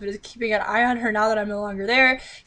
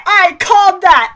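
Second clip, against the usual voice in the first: higher, louder, slow "called"; gloating shout; 8 semitones higher, 10 dB louder, slower than usual. Real, not acted. Reader to viewers, gloating loudly. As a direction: shout the victory.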